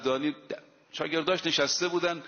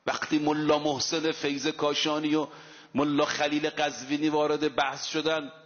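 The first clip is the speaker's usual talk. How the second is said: normal talking voice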